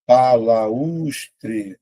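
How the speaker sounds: BALAUSTRE drawn out as ba-la-us-tre, U on its own; syllable by syllable. Shown as a demonstration. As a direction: stretch each syllable of BALAUSTRE. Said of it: "Vou separar".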